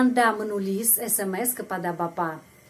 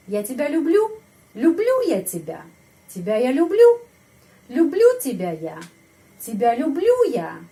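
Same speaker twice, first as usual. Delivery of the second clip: люблю high and loud wherever it falls; stress on люблю; 4 semitones higher, 7 dB louder than usual. Shown as a demonstration. Teacher drills question word orders, stress on люблю. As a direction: hit люблю with a raised, questioning pitch. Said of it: "Ini ya penekanan intonasi pada ini".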